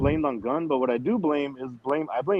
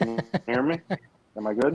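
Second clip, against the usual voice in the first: choppy connection; 4 semitones lower than usual. Real, not acted. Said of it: "It's a little choppy".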